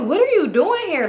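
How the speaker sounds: high-pitched, drawn-out retold challenge. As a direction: imitate her demanding an explanation, slowly and high. Challenging someone who showed up.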